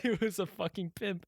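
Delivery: real amusement, high-pitched through laughter; laughing. Real, not acted. Telling a friend about the show's pimp, real laughing. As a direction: laugh the words out.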